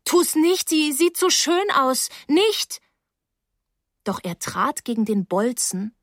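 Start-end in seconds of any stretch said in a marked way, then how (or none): 0.0-2.8 very high, imploring voice; anxious pleading. A narrator's reading. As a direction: plead for it to be spared, pitch climbing. Girl begs Rose to spare stairs.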